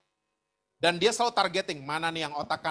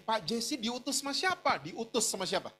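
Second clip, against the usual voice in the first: imitating a tearful, higher-pitched questioner; mock-tearful questions climbing high at the end; retold tearful disbelief; 6 semitones higher than usual. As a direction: voice a neighbour's tearful astonishment, pitched up. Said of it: "Dia nangis, dia bilang gini".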